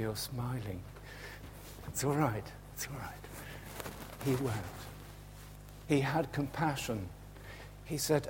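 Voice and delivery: ordinary talk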